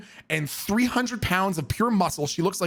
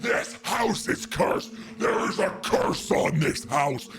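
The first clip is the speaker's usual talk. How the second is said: hoarse, slow ranting doomsayer, mock screamed; mock screaming preacher woman; 4 semitones lower than usual. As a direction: play the street prophet howling curses. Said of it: "she's screaming".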